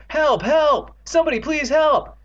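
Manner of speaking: high, loud panicked pleas; screaming for help. A narrator's reading. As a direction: scream for rescue from a monster. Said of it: "Screaming at the top of their lungs".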